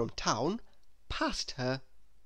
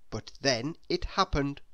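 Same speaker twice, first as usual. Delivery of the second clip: ordinary delivery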